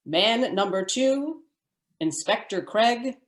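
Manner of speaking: ordinary talk, nothing marked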